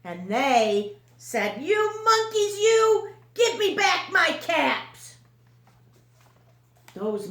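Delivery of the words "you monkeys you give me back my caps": high, loud mock scolding; monkeys copying angry peddler. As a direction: echo the peddler's angry shout. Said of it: "copied the peddler".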